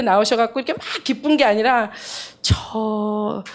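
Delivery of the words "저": a slow, hesitant hint of a careful doctor; very cautious doctor. Doctor cautiously telling the visiting woman she is pregnant, slow and hesitant. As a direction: tread gently, as if the news might be unwelcome. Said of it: "이렇게 굉장히 조심스럽게 해서".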